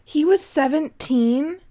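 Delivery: surprised. Real, not acted. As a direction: ask it in real shock. Shocked reaction to age.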